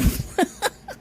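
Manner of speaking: breathy squeaky laugh; high airy giggle. Real, not acted. After "Crisco.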